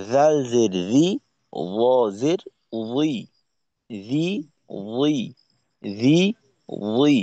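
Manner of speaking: রি thin, উই thick and rounded; thin and thick letters. Shown as a demonstration. Teacher contrasting two Arabic letters, thin against thick. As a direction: alternate thin রি and heavy উই. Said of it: "মোটা পাতলার মাধ্যমে".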